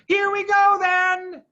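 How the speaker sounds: high, loud battle cry; shouted. A narrator's reading. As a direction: bellow it as the engines start. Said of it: "shouted Bean".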